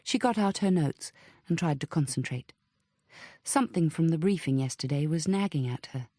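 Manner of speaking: ordinary delivery, nothing marked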